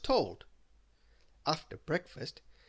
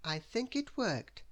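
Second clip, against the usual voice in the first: high, slow character voice; measured high little voice; Little Miss Neat; 9 semitones higher, slower than usual. A narrator's reading. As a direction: announce it slowly, pitched up. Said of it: "announced Little Miss Neat".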